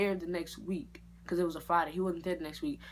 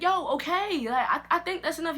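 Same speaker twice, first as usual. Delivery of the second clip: high, loud panicked protest; loud alarmed plea; 10 semitones higher, 7 dB louder than usual. Retold or imitated. Telling the barber to stop cutting, a high panicked yell.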